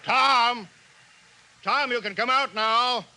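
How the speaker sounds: high, loud call across distance. Acted. Mayor calling to hidden Tom that the coast is clear, loud call. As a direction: shout for him to come out.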